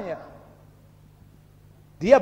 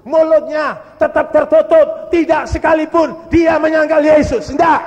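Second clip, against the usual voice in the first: loud, raised-pitch proclamation, each phrase hammered; emphatic preaching shout; 4 semitones higher, 9 dB louder than usual. Real, not acted. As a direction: thunder it out to the congregation. Preacher's climax of the testimony.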